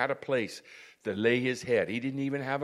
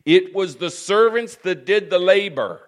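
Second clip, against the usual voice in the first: loud, raised-pitch sermon delivery; forceful preaching; 7 semitones higher, 11 dB louder than usual. Real, not acted. Preacher telling how servants did the labor, forceful preaching. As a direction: proclaim it with rising force.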